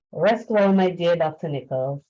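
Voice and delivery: normal talking voice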